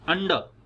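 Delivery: "अंडर" as "UN-duh", no R at the end; silent R. Shown as a demonstration. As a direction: end "अंडर" on a schwa, dropping the R. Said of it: "आर को pronounce नहीं करेंगे". ("अंडर" के अंत में र नहीं, हल्का अ)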